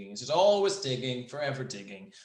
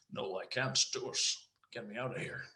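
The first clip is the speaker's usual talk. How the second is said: quiet, off-target impression; terrible Sean Connery; 7 dB quieter than usual. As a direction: softly attempt a famous actor, missing the mark. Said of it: "no, that was terrible. It sounded more like Liam Neeson".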